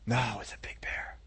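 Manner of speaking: soft, low, half-whispered correction; hushed earnest reply. Acted. David answers the teddy bear teasing, hushed and earnest. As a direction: protest quietly, almost under the breath.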